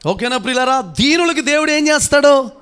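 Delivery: high, loud preacher's call; rousing question. Real, not acted. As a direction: throw the question out at full voice. (గొంతు పెంచి సంఘానికి గట్టి ప్రశ్న)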